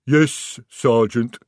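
loud troll reply; troll rumble. A narrator's reading. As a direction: answer like a big troll. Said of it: "rumbled the troll".

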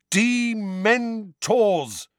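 high, drawn-out "Dementors"; slow and clear. A narrator's reading. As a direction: spell out "Dementors" syllable by syllable. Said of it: "slowly and clearly".

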